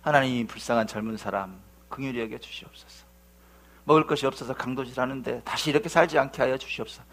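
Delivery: low, soft, reverent pleading; hushed, acted-out pastor's prayer; earnest prayer. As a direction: pray quietly over someone. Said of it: "하나님께 간절히 기도한 거예요".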